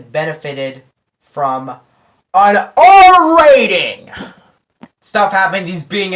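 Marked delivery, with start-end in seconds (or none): 2.3-4.1 "R rating" drawn out high and loud; stress on R rating. Real, not acted. Vlogger insisting the film needed an R rating, emphatic. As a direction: lean hard on "R rating".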